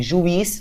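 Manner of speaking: juiz ending on stressed i closed by z; masculine pronunciation. Shown as a demonstration. Teacher contrasting masculine and feminine, i closed by z. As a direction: say juiz as ju-IZ, z closing the syllable. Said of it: "uma diferença de pronúncia".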